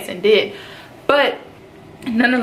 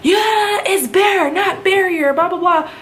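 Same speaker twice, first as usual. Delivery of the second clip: mock instructor snapping a correction, much higher; 10 semitones higher, slower than usual. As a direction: parrot the instructor's correction in a high mocking voice. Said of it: "and this M T I just".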